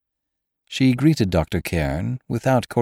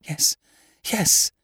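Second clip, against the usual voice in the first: anxious assent; 13 semitones higher than usual. A narrator's reading. Frightened lady confesses.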